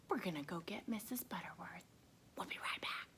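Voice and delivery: fast hushed whisper. Real, not acted. Teacher whispering before fetching the puppet. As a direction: whisper quickly, conspiratorial.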